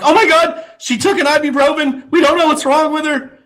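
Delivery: high, overwrought imitation of school staff; mock panic. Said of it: "So they're like".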